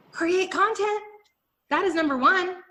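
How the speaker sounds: create content leapt up high; high emphatic. Shown as a demonstration. Pitching her main point to mompreneurs, high emphatic. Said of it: "I need to just simply create content".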